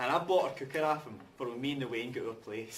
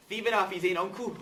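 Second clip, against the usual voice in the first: angry; 6 semitones higher, 7 dB louder than usual. Acted. Angry confrontation.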